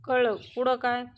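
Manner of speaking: fed up. A narrator's reading. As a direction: cut him off, sick of hearing it. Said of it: "Aishwarya वैतागते".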